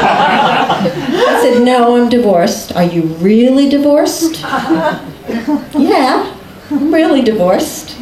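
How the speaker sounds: high, giggly retold exchange; laughing through it. Real, not acted. Guest laughing retelling the divorced exchange. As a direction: laugh between the lines.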